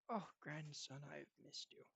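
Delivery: soft, tender greeting; warm grandfather. Acted. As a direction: greet your grandson with quiet affection. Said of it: "he hugs him very warmly".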